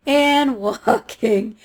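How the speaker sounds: "walking" drawn out very slowly, laughing. Shown as a demonstration. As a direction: stretch "walking" further, laughing at the distance. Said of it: "repeated walking, drawn out".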